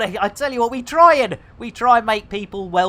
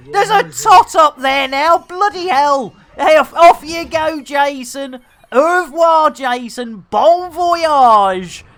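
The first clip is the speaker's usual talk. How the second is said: louder, higher gleeful calls; excited; 7 semitones higher, 7 dB louder than usual. Real, not acted. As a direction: shout goodbye with glee. Gleefully spacing Jason.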